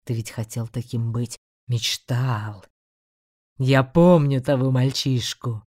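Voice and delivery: low, tempting demon voice; a demon coaxing with menace. A narrator's reading. (низкий искушающий голос демона)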